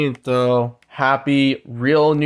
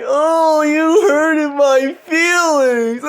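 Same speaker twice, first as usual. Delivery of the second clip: much higher, slow whiny crybaby voice; mock hurt feelings; 13 semitones higher, 4 dB louder, much slower than usual. Mocking offended people whining about hurt feelings, high and slow. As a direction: whimper it like a sulking child. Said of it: "they're like".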